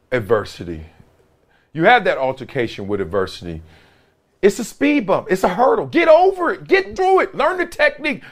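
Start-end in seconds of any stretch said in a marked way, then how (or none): 5.9-8.2 high, ringing pep talk; pumped coaching. Real, not acted. Speaker hyping his audience, high and excited. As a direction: fire up the listener to push through. Motivational hurdle speech.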